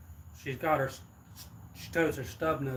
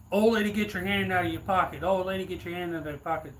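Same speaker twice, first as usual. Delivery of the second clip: robber's demand; 5 semitones higher, 5 dB louder than usual. Retold or imitated. Robber ordering her hand out.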